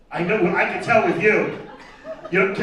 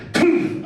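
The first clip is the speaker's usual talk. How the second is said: blowgun poof; 8 semitones higher than usual. Retold or imitated. Blowgun dart sound.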